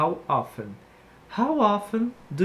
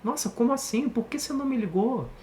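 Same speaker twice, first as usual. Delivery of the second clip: exclamation; 6 semitones higher than usual. Shown as a demonstration. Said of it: "uma exclamação".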